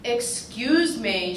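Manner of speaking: slow, sharp New Jersey "Excuse me"; drawn-out Jersey accent, a touch sharp. Retold or imitated. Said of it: "in a sharp Jersey accent".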